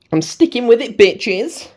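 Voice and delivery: cheeky defiant. Real, not acted. Cheeky defiant sign-off to viewers.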